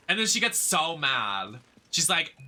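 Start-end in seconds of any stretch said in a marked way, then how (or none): none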